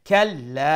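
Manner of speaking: كلا recited loudly with a drawn-out final aa; chanted كلا, long ending; one alif long. Shown as a demonstration. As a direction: hold the last vowel of كلا steady. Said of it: "এক আলিফ লম্বা".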